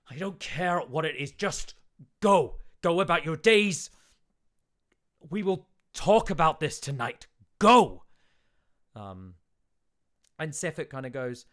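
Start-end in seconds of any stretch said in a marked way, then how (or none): 0.1-8.1 high, loud shouted orders; angry dismissal. Acted. Town speaker furiously ordering the priest and Sefik to leave. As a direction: bark them out of his sight. Oris shouting them away.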